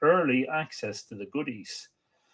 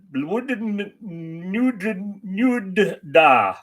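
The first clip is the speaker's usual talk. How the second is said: slow, drawn-out mock Welsh, pitched higher; bad Welsh accent; 7 semitones higher, 4 dB louder, much slower than usual. Shown as a demonstration. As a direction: stretch out a clumsy Welsh New Year greeting. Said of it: "the worst Welsh impression you've ever heard".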